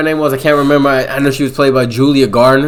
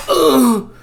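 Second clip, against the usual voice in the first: pained grunt; 13 semitones higher than usual. Retold or imitated. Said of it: "He's like".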